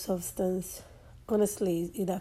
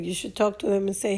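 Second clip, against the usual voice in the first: ordinary delivery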